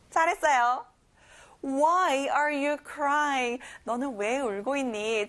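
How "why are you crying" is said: very slow, stretched question; very slow model. Shown as a demonstration. Teacher models the question very slowly. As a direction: drag each word out for learners. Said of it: "why가 또 앞에 나왔죠".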